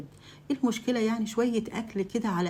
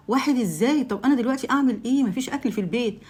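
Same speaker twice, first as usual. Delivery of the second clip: higher, louder, upset; alarmed protest; 5 semitones higher, 4 dB louder than usual. Acted. Wife confronting husband over surprise guests, alarmed and loud.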